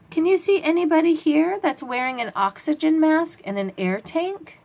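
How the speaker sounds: put-on high, lively teacher voice for kids; bright questioning. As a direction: ask the children eagerly. Asks kids to spot it.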